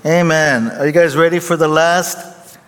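stage hype voice; rallying the crowd. Real, not acted. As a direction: pump up the congregation. Pastor rallies congregation.

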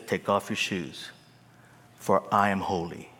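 low, quiet, grave command; quiet divine authority; solemn God voice. Retold or imitated. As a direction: speak as holy God, hushed. God's command, low and soft.

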